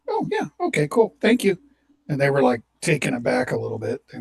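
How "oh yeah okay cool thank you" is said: much higher, louder chirpy thanks; cheerful. Retold or imitated. Laid-off man answers HR with mock cheer. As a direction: sing out the thanks like great news. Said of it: "and I was like".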